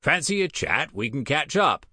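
high, hopeful little imp; imp demon voice. Acted.

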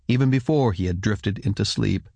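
ordinary delivery, nothing marked

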